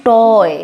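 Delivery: টয় with an অ vowel, said firmly; English word pronunciation. Shown as a demonstration. Teacher modelling toy for students, clear and emphatic. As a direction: lean on the অ in টয়. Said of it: "অ এর মতো হচ্ছে".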